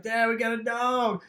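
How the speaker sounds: put-on a young boy proudly telling his dad; excited kid. Young son shows his dad the new dog, lightly voiced kid.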